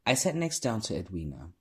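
ordinary delivery, nothing marked

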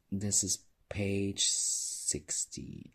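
usual speaking voice

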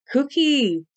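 loud, high call for Cookie; yell. A narrator's reading. Waiter yelling for Cookie into the kitchen, urgent shout. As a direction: yell it out in a rush. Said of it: "I yelled as I skidded".

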